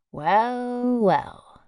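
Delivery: high drawn-out first well, then falling; a knowing well well, unreadable. Acted. Postmistress after the stranger leaves, knowing and unreadable. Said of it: "with impenetrable equanimity".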